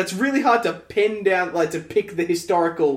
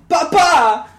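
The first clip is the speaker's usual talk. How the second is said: Papa! called higher and louder with French stress on the end; mock French Papa; 8 semitones higher, 6 dB louder than usual. Imitating Belle's one French word, a loud French-style call. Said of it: "She said".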